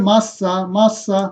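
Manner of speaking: "মাসা" very slow, with a held doubled s; model pronunciation. Shown as a demonstration. Teacher answers how the word reads, slow drawn-out "মাসা, মাসা". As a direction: draw out each "মাসা" slowly for learners. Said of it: "কি হবে?".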